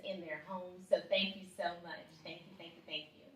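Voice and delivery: ordinary delivery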